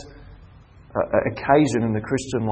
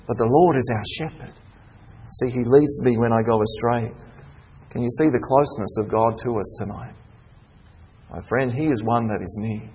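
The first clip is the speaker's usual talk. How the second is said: much softer, lower, intimate sermon voice; tender reassurance; 3 semitones lower, 5 dB quieter than usual. Acted. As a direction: draw the flock close gently. Soft tender sermon passage.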